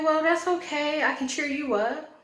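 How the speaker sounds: lightly mocking, high-pitched sweet-talker; high mock-cheery quote; imitating eager flatterer. Quoting man trying to charm her.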